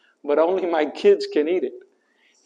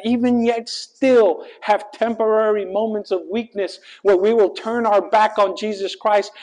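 preaching at full force; 5 semitones higher than usual. Acted. Preacher drives home to the congregation that he never forsakes them, full preaching force. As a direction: build it like a pulpit climax.

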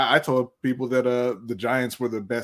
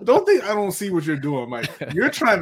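higher, louder, laughing; laughing call-out; 8 semitones higher, 5 dB louder than usual. Real, not acted. Host calling out a sneaky donor, laughing and loud. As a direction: catch him out with a laugh.

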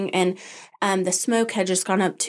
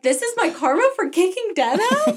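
mock guilty thought; 11 semitones higher than usual. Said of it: "And then I'm thinking".

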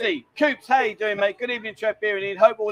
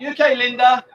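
louder, higher check-in call; 4 semitones higher, 7 dB louder than usual. Real, not acted. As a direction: call across to someone to check on them. Calling out to check on Linda.

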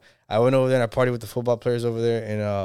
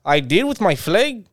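put-on North Dakota accent; 7 semitones higher, 4 dB louder, slower than usual. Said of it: "North Dakota accent".

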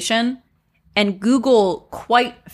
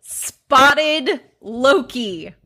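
slow careful retry; 5 semitones higher, 3 dB louder, much slower than usual. Shown as a demonstration. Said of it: "I could retry it".

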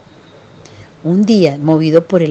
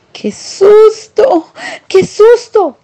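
high, loud, drawn-out frightened cry; exclaimed fright; 13 semitones higher, 4 dB louder, much slower than usual. Acted. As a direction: shriek it slowly like scared mice. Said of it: "exclamaron los dos ratones". (grito asustado, agudo y fuerte)

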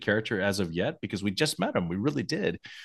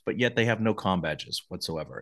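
ordinary talk, nothing marked

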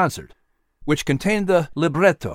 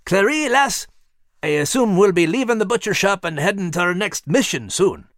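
higher, hearty Scottish brogue; 6 semitones higher than usual. Acted. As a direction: bold Scottish sailor's brogue. Max voiced, brogue.

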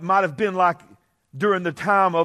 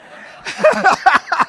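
high laugh; 12 semitones higher, 7 dB louder than usual. Real, not acted. Laughing at own story.

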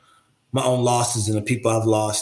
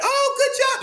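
much higher, lightly imitating a proud friend's praise; mock excited praise. Imitating a friend's proud praise, high and excited. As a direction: squeak it out like a delighted friend cheering you on.

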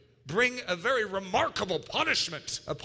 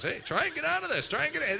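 higher, fast teasing dare; playful taunt; 8 semitones higher, faster than usual. Retold or imitated. Dad play-wrestling with his kids, mock taunting them. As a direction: tease the kids pinned under you.

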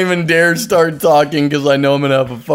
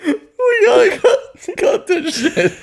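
laughing. Real, not acted. Laughing desperately.

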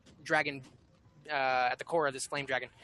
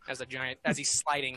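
ordinary delivery

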